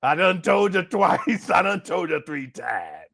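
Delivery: slow drawn-out granny scolding; slow stern warning. Retold or imitated. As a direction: spell it out slowly, laughing. Granny archer warning retold.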